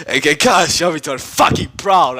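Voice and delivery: ordinary delivery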